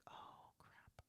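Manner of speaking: breathy whispered oh crap; whispered dismay. Retold or imitated. Private dismay at the news.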